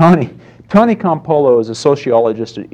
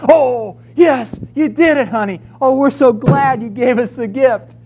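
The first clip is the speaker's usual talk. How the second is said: much higher, gushing mock delight; overjoyed parent; 13 semitones higher than usual. Parent cheering the toddler who finally went, high gushing joy. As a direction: squeal with delight over the toddler's success.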